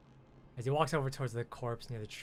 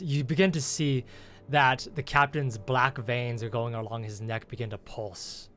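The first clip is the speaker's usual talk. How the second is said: ordinary talk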